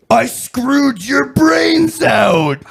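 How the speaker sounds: high, very slow hammed-up yell; mock triumphant shout. Wrestler boasting over the coffin, mock triumphant shout. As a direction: draw each word out in a mock roar. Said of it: "And then proceeds to say".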